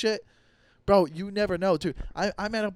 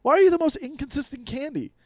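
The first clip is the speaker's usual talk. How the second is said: high, louder indignant rant; mock outrage at candy; 10 semitones higher, 5 dB louder than usual. Scolding a stale Whopper in high mock outrage. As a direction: scold the candy like it betrayed you. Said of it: "you're like".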